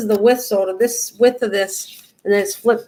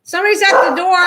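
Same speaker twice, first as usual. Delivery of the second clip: raised, high call to someone in another room; 9 semitones higher, 4 dB louder, faster than usual. Real, not acted. Calling to family in the house that someone's at the door.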